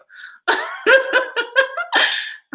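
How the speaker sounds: high, loud burst of laughter; big high-pitched laugh; real laughter. Real, not acted. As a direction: crack up in a high cackle. Cracks up over viewers' accents.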